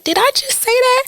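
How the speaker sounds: high-pitched disbelieving question; mock shock. Host recalling first cussing on air, high mock shock. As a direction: act out shocked disbelief at yourself.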